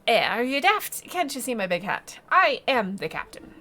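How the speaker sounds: loud, affronted sea-captain voice; indignant fish captain. Acted. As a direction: bluster like an insulted pirate captain.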